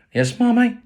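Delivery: put-on very high, sweet obedient child; good boy voice. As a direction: play the angel son for Ma. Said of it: "in my good boy voice".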